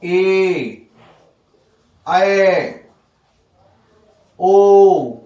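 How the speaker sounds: ए, ऐ, ओ each held out long; long vowels. Shown as a demonstration. Teacher reciting examples of long vowels, each drawn out. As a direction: sustain ए, ऐ, ओ one by one, with pauses. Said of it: "सारे के सारे वो दीर्घ स्वर".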